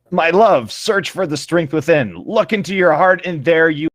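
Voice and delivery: slow, lifted, melodramatic plea; hammed-up earnest encouragement. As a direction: coax him like a soap heroine. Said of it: "I said to him telepathically".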